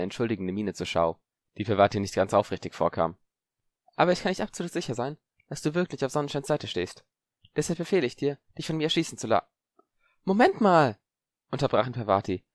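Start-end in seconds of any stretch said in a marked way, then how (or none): 4.0-9.5 put-on higher smooth boyish voice, falsely apologetic; lightly voiced schemer; a boy feigning regret; sly boy Zabini. 10.2-11.0 high, louder sudden stop; startled girl's objection; alarmed interruption. Acted.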